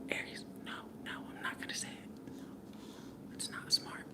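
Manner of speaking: hushed whisper to herself, holding it back. Real, not acted. Whispering to herself so she won't say it. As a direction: whisper it like you're stopping yourself.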